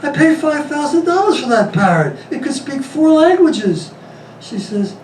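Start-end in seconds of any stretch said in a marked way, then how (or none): 0.0-3.9 higher, pleading son; exasperated son. Acted. Son protesting to his mother, pitched up and pleading. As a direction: plead with Ma in a raised voice.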